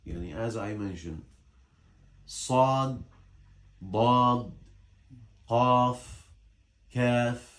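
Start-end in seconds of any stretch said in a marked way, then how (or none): 2.2-7.5 Sad, Dad, Qaf, Kaf sounded out one by one; distinct Arabic letter articulation. Shown as a demonstration. Teacher showing letters people mix up, Sad, Dad, Qaf, Kaf articulated distinctly. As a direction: give each letter, Sad to Kaf, its own articulation point. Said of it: "from where you pronounce the letter".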